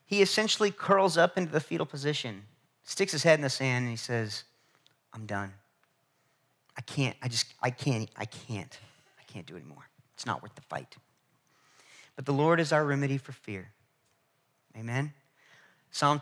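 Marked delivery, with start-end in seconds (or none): 5.1-11.0 defeated murmur. Retold or imitated. Elijah curled up, giving up.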